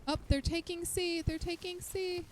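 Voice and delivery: high-pitched, urgent callout; urgent alarm. Real, not acted. Player warning that C is being taken, urgent alarm. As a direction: call it out in alarm. Alerting as point is captured.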